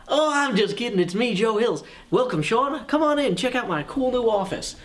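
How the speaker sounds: hammed-up TV host. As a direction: sell the office like a home-show host. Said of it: "pretend I was Bob Vila".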